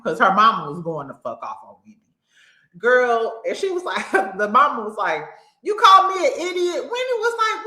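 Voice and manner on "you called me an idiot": high, loud mock outrage; raised-voice mock accusation; retold indignant challenge. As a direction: imitate an affronted mama, pitched up. Acting out the angry mama's challenge.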